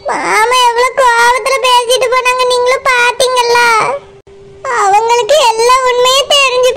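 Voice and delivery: weeping bride telling why they won't return; crying. Acted. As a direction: sob it out as she explains. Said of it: "மைனா, அழாத".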